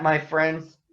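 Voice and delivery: ordinary delivery, nothing marked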